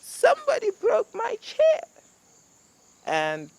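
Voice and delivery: very high, loud, slow cub squeal; Baby Bear. Acted. Baby bear cries out over his broken chair. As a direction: wail it like a heartbroken little cub. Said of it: "Baby Bear says".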